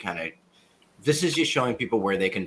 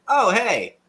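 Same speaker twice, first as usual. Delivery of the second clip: high, louder casual greeting; imitating the boss; 12 semitones higher, 7 dB louder than usual. Retelling the boss spotting a cracked laptop, lightly imitated. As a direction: voice a boss breezily noticing something. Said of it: "he was just like".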